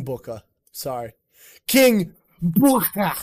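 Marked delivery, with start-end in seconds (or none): 1.7-3.1 loud, drawn-out royal announcement; wrestling announcement. Retold or imitated. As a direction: proclaim the name slowly, higher and louder. Mimics grand wrestling announcement of King Booker.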